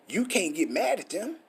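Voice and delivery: ordinary delivery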